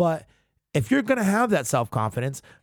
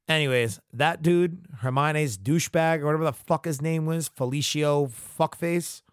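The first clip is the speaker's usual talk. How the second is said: ordinary delivery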